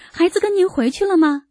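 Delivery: high-pitched urgent question; anxious question. A narrator's reading. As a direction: ask anxiously, pitch well up. Urgent phone call about missing child. (焦急地询问)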